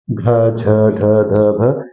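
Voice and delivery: घ, झ, ढ, ध, भ recited low as breath-released syllables; aspirated sounds. Shown as a demonstration. Teacher demonstrating the fourth letters to learners, घ, झ, ढ, ध, भ said aspirated. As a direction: sound out घ, झ, ढ, ध, भ with extra air behind each. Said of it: "ज्यादा मात्रा में वायु बाहर निकल रही है".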